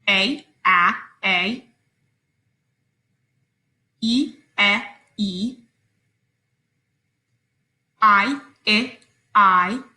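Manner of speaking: each vowel name then its short sound; vowel sounds drilled. Shown as a demonstration. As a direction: say "A" then "a", "E" then "e", slowly. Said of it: "follow my lead".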